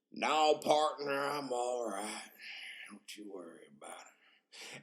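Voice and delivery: slow drawl, fading to very soft; drawn-out reassurance. Retold or imitated. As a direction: stretch it out, then let it trail away. Acted slow reassurance to the officer.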